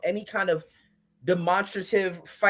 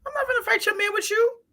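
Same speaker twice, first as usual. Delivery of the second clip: much higher incredulous refusal; high exclaim; 14 semitones higher than usual. Retold or imitated. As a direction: squeal it in disbelief.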